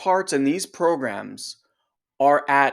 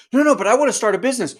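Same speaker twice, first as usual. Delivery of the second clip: higher, louder, fast whine; pleading self; 8 semitones higher, 6 dB louder, much faster than usual. Retold or imitated. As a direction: blurt the wish out quickly. Self pleads to inner part.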